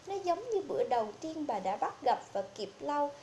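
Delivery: ordinary delivery, nothing marked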